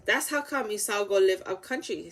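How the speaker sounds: plain reading voice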